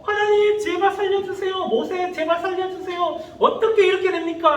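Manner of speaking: high-pitched mock pleading; acted desperate cry for help; frightened crying out. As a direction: plead for your life like a panicked crowd. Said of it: "무서워서 부르짖었죠".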